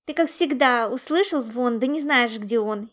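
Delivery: loud, mocking disbelief; playful scoffing; giggly teasing. A narrator's reading. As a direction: poke fun at a friend's tall tale. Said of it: "хихикнул Лунчик".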